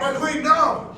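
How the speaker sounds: high, loud desperate shout; horrified cry. Acted. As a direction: cry out to stop her. Servant cries out.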